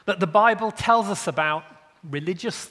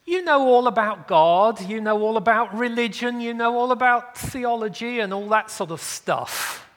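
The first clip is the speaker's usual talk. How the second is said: higher-pitched, politely sarcastic flattery; nicely veiled sarcasm; 5 semitones higher, 3 dB louder than usual. Acted. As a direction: pay the compliment nicely with irritation underneath. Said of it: "he says it quite nicely".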